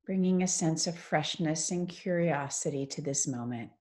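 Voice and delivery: guided meditation. Acted. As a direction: ease them into stillness, phrase by unhurried phrase.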